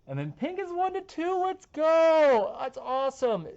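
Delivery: high, loud rain hype; excited. Real, not acted. Forecaster cheering the pink rain totals, excited. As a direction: cheer it like a touchdown.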